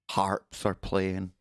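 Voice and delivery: usual speaking voice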